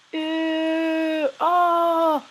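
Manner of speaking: high, loud startled yelp; disgusted squeal. Real, not acted. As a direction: squeal as it pulls your skin. Peeling off the mask.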